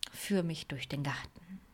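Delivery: soft whispered plea; whispering. Pretend. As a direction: whisper it to mum tenderly. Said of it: "und sagen".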